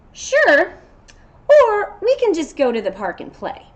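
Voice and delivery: high and loud, then warmer; happy to have a new friend; storybook goat glad to be friends; friendly goat. Acted. Goat and Unicorn make friends.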